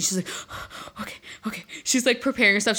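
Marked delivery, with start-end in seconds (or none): 0.3-1.0 sharp breathless gasp, imitating a nervous flyer; a mock nervous gasp for breath; panting gasp. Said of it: "like, panting". 1.0-1.6 whispered, breathless self-calming; mock nervous whisper to herself; breathless okays. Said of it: "like, panting".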